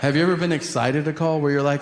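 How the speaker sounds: ordinary delivery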